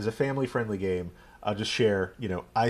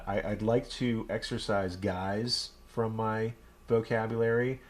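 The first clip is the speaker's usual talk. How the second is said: ordinary talk, nothing marked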